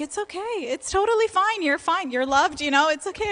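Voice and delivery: a hint of a gentle father saying it's okay; imitating soothing dad. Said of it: "He's like".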